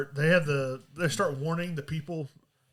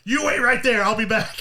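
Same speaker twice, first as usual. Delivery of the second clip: louder, higher order; mock command; 5 semitones higher, 7 dB louder than usual. Captor leaving cuffed Jack, mock command. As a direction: call it out firmly, jokingly.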